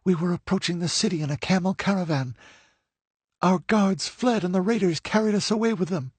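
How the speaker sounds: put-on high young woman's voice, rushing at first; hurrying through her story before she is missed; hurried telling. A narrator's reading. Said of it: "she continued hurriedly".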